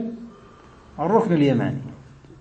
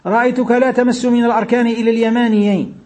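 higher-pitched, measured formal reading; hadith text recitation; 5 semitones higher, 3 dB louder than usual. Acted. Reads the hadith text aloud. (قراءة متن الحديث بصوت مرتفع ممدود)

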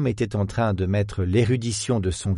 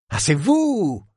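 high, loud cheerful exclamation; light up with recognition; 7 semitones higher, 3 dB louder, slower than usual. Acted. Professor recognises the detective, high cheerful exclamation. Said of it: "s'exclama Van de Cade, enjoué".

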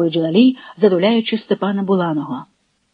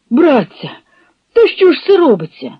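high, loud and fast outcry; cutting shout; 5 semitones higher, 4 dB louder than usual. A narrator's reading. A Cossack shouts out, alarmed that their elder is beaten, loud. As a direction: cut through the silence with alarm. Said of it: "різонув хвилину тишу чийсь дуже баритон".

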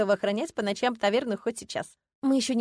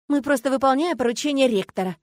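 упрямо; 6 semitones higher, 6 dB louder than usual. A narrator's reading. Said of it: "упрямо ответила я".

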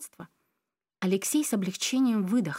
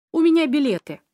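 higher, louder proud announcement; excited reveal; 5 semitones higher, 8 dB louder than usual. A narrator's reading. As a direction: show off the tickets eagerly. Triumphant reveal of tickets.

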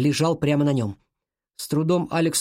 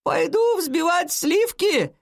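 high, slow call from downstairs; granny's stretched, high-pitched call; grandmother shouting; 14 semitones higher, slower than usual. A narrator's reading. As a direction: holler an announcement through the house. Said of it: "крикнула она".

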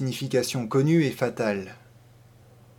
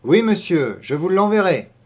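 higher, louder, emphatic assent; eager farmer's wife; 5 semitones higher, 6 dB louder than usual. A narrator's reading. Farmer's wife promising to send the maid, eager. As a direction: promise it loudly and high.

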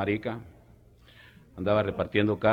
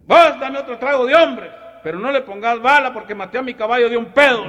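high, loud country holler, laughing at the end; rough shout; 12 semitones higher, 8 dB louder than usual. Retold or imitated. Rigo asking the bartender for another drink, high and loud. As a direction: call it out like a crude cowboy.

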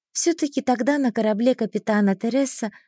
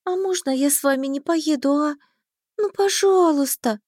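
pleading; 6 semitones higher than usual. A narrator's reading. Heroine begs not to go, pleading. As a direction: wheedle it, stretching the final word. Begging to stay home.

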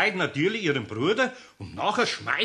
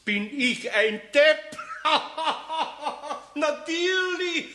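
high, laughing self-mockery; giggling at his own foolishness; 9 semitones higher than usual. Real, not acted. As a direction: laugh through the realisation. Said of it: "Was gibt's denn da zu lachen?".

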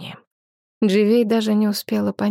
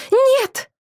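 high, loud desperate scream; 5 dB louder than usual. A narrator's reading. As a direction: scream it in horror. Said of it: "закричала Дживей".

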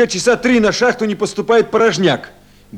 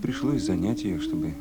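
much softer, half-whispered request; hushed; 16 dB quieter than usual. Acted. Officer asking the women to calm the girl, hushed. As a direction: ask for help without waking anyone. Whispering beside sleeping children.

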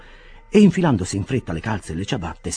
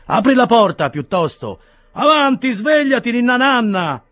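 much higher and louder teasing bearer; mocking encouragement; 7 dB louder than usual. A narrator's reading. Second bearer mockingly hurrying the sleepy man, loud and high. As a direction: jeer him awake. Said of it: "aggiunse l'altro a titolo di incoraggiamento".

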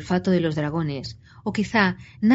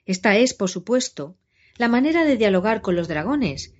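plain reading voice

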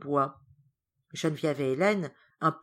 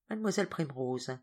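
plain reading voice

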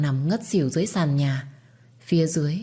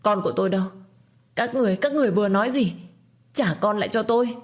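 mild panicked pleading; 6 semitones higher, faster than usual. A narrator's reading. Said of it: "hoảng loạn và gào thét".

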